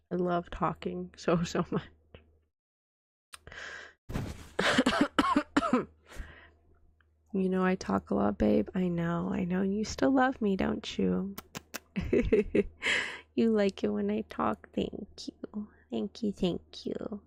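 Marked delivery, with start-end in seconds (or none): 4.1-5.8 a real rough cough. Real, not acted. Sick streamer coughing. 14.7-17.2 soft whispered thanks. Real, not acted. Flirty banter with partner.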